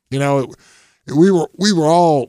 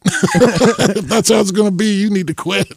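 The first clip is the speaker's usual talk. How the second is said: higher, louder, laughing as he says it; 10 semitones higher, 7 dB louder than usual. Retold or imitated. Quoting friends telling Barkley to quit, laughing. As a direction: blurt it out amid laughter.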